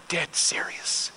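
soft, slow, almost breathed; a hushed, dead-earnest promise. Retold or imitated. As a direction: drop to a near whisper and mean it. Pastor's earnest reply to Joy.

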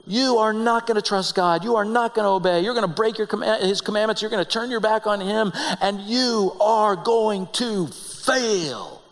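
high, emphatic pronouncement of failure; Moses. Acted. Preacher as Moses tells Israel they will disobey, emphatic verdict. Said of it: "you know what Moses says".

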